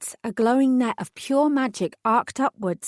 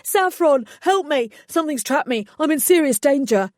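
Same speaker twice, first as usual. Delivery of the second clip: high, loud panicked pleading; yelping in panic; 6 semitones higher, 5 dB louder than usual. A narrator's reading. Trapped fairy begging Saffron for help, high panicked yelps. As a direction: yelp for help like he's in mortal danger. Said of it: "he yelped".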